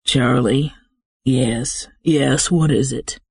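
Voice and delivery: low, impatient. A narrator's reading. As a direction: push for an answer, impatient. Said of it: "the impatience in his voice".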